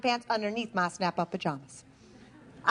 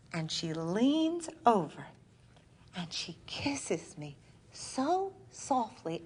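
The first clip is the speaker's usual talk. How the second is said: slow, softer, hushed; hushed, dreamy first kiss; tender recall; 2 semitones lower, 5 dB quieter, slower than usual. Acted. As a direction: linger on it softly.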